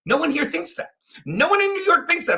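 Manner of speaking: high-pitched retold yell. Host retelling his chat argument, mock yelling. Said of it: "'cause I'm yelling".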